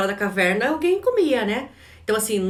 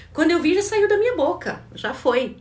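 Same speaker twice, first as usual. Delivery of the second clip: startled client; 9 semitones higher, 4 dB louder than usual. Retold or imitated. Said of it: "clientes que falam assim".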